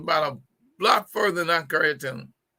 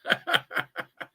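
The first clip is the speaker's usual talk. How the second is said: amused run of chuckles; laughing without words; real laugh. Real, not acted. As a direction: chuckle at your own joke. Laughs at own repeated joke.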